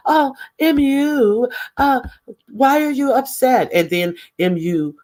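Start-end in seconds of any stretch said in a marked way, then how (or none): 0.1-3.7 mock concern. Micromanager mimicked asking the upset woman why, mock concern. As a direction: draw out the fake concern up high.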